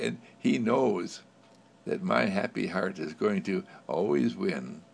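ordinary talk

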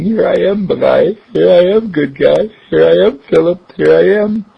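high, eager dog voice; the dog nuzzling in, delighted; dog's happy voice. Acted. Duke greeting Philip nose to nose, high and eager.

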